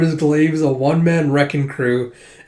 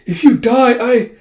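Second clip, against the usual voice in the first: high, loud broken-off plea; breaking off a frantic plea; anguished cry; 10 semitones higher, 7 dB louder than usual. Acted. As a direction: call out and break off. Anguished cry cut off in fight.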